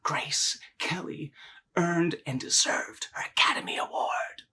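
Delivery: whisper. Retold or imitated. Mother to daughter mid-hug, into her ear, whispering. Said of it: "she, she whispers".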